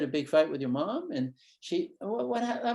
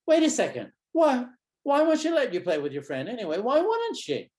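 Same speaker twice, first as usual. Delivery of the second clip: higher and louder, puzzled wonder; mock surprise; 10 semitones higher, 6 dB louder than usual. Shown as a demonstration. Therapist feigning puzzlement to draw out a child, mock surprise. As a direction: act suddenly baffled for the kid. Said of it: "So I'm there pausing and say".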